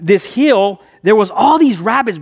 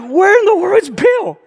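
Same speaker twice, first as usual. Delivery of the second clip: high-pitched, incredulous cry; acted panic at a missing friend; exasperated, pitched-up question; baffled alarm; 11 semitones higher than usual. Searching for the vanished friend.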